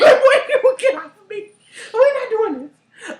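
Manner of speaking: high, loud, laughing protest; hammed-up outraged refusal. Pushing the date off of her, loud mock outrage. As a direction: fend them off at full pitch through laughter.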